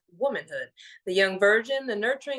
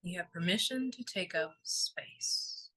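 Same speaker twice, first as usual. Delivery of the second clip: guided meditation; 3 semitones lower than usual. Real, not acted. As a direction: gently guide them into stillness, letting each phrase settle.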